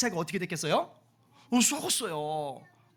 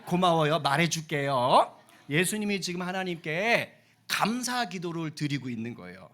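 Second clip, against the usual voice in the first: usual speaking voice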